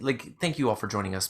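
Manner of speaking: ordinary talk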